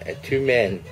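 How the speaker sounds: usual speaking voice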